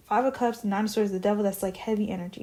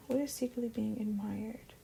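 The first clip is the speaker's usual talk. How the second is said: normal talking voice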